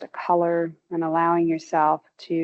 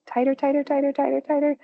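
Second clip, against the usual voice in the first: each tighter higher, climbing as it repeats; building urge; 7 semitones higher, faster than usual. Real, not acted. Instructor urging listeners to tense their legs, rising urging. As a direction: push the tension up with every repeat.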